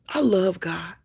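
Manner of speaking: normal talking voice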